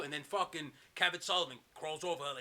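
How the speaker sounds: ordinary talk, nothing marked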